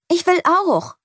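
put-on very high, eager child's voice; girl squeezing onto the bench, high and eager; eager call. A narrator's reading. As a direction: call out wanting to join in. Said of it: "ruft Lea".

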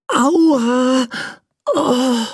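high, mild pained cry; a light mock ouch from the boy; prince ouch. Prince wakes in the toy box, mildly aching. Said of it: "rief der Prinz".

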